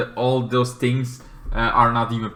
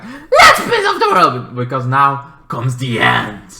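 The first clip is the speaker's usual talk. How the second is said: alien creature; 5 dB louder than usual. Retold or imitated. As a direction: cackle it out like a gleeful monster. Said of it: "alien creature is like".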